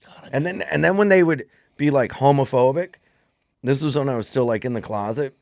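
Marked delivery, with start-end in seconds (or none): none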